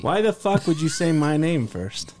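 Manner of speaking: indignant joking protest; mock outrage. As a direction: play it offended.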